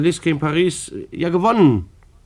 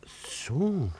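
breathy under-the-breath so; a doubtful whisper to himself; whispered aside; 2 semitones higher, 11 dB quieter than usual. Acted. Clerk doubts Frau Fino's story, whispered.

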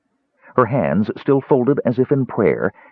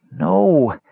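moaned; 7 semitones higher than usual. A narrator's reading. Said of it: "Tass moaned".

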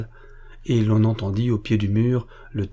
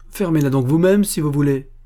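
loud, higher irritated retort; angry retort; 5 semitones higher, 4 dB louder than usual. A narrator's reading. Rüdiger snaps back at the nagging sentinel, loud and irritated. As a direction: throw it back at him, annoyed.

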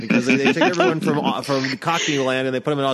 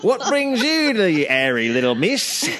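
put-on British accent sliding toward Australian; hammed-up British householder; British gent accent; 6 semitones higher, slower than usual. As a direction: play a British gent greeting a caller. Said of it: "What happened to the British gent?".

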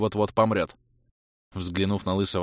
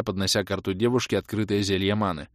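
usual speaking voice

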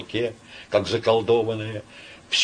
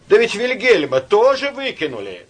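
Karas objecting; 12 semitones higher, 7 dB louder than usual. A narrator's reading.

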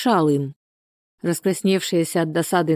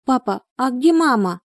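put-on high child's voice asking; high, thin girlish question; thin little-girl voice; 7 semitones higher than usual. A narrator's reading. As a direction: play a small girl asking her father. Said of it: "тонкий девчоночий голосок".

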